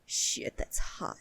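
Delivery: breathless hushed whisper, unvoiced; mock whisper. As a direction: whisper it like a flustered confession. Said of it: "then he's like".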